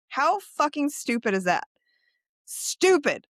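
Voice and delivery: ordinary delivery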